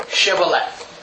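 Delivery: low "Shibboleth" beginning with SH; correct pronunciation. Shown as a demonstration. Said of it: "could not pronounce".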